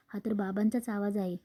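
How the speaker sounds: plain reading voice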